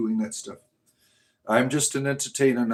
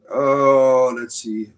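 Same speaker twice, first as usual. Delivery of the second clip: normal talking voice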